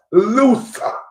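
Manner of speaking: mock Boston accent calling someone Loosa. To the chat, mocking a rival as a loser in a Boston accent. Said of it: "in my Boston voice".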